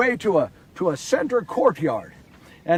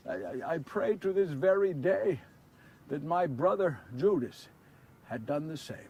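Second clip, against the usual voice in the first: much softer, earnest confession; sincere and hushed; 2 semitones lower, 8 dB quieter than usual. A narrator's reading. Peter confiding in his listeners about Judas: soft, sincere. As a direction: lean in and confide it quietly.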